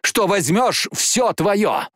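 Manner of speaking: high, impassioned call; excited cry. Acted. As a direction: cry it out with abandon. Jane cries out, aroused.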